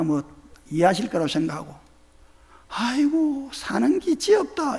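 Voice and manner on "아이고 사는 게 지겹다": Gyeongsang dialect, higher and slow; a weary old Gyeongsang grandmother; grandma's Gyeongsang sigh. Retold or imitated. Pastor quoting his grandmother, Gyeongsang weary sigh. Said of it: "이러더라고요".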